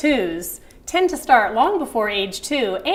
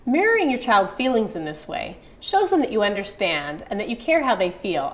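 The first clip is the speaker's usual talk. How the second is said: ordinary delivery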